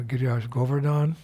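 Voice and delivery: usual speaking voice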